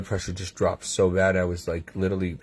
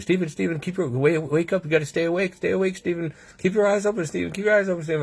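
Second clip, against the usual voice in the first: higher-pitched imitation of urgent pleading; urgent medical team; 8 semitones higher, faster than usual. Retelling the team trying to rouse him, imitated urgent pleading. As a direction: imitate a team calling a patient back. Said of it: "they called the team, and they're all working on me".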